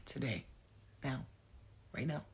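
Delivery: slow, soft and low, with long pauses; solemn reassurance. Real, not acted. Reader urging listener to forgive themselves, solemn and hushed. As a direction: gently lay down each phrase, unhurried.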